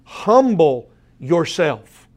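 HUMBLE drawn out, a gap before YOURSELF; emphatic stress. Shown as a demonstration. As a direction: slowly press "Humble yourself" on the listeners. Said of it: "The emphasis on this is".

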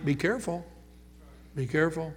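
hushed, earnest caution; softly repeated warning. Real, not acted. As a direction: drop the voice to a soft warning. Preacher warns the congregation.

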